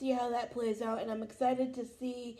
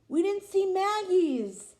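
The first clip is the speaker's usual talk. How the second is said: shocked realization; 8 semitones higher, 6 dB louder than usual. Real, not acted. Realizing a missed scene.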